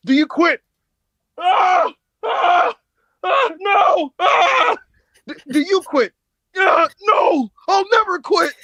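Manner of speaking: high, loud hammed-up screams and questions; mock ref and wrestler. Mocking the referee's I Quit match, in high hammed-up screams. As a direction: ham up the yelling wrestler. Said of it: "the ref going".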